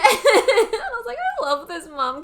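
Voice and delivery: high, loud, laughing adoration; laughing gush. Real, not acted. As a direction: gush through your laughter. Gushing through laughter about the mum.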